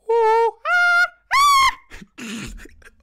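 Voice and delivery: very high loud screech sliding down; long falling monkey screech. Shown as a demonstration. Demonstrating the high monkey noise, a falling screech. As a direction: shriek then let it fall. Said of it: "the pitch you're going for".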